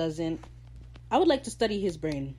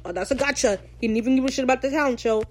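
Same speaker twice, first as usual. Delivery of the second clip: mocking fans; 6 semitones higher, 3 dB louder, faster than usual. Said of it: "So y'all being like".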